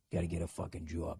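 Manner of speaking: low, soft Boston "job"; Boston accent. Shown as a demonstration. Host demonstrating for accent learners, Boston accent. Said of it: "working on your Boston accents".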